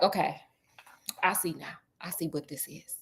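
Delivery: low, soft, trailing musing; quiet musing. Retold or imitated. Sherlock works out what he just saw, quiet musing. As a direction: think it out under your voice.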